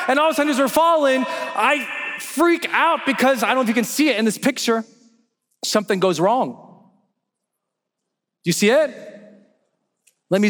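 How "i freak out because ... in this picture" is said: usual speaking voice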